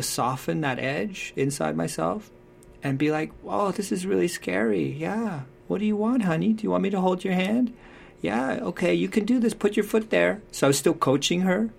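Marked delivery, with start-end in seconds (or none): 3.4-10.4 put-on higher, gentle coaxing to a scared child; reassuring mother. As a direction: soothe her up the climbing frame. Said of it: "I was reassuring her and holding".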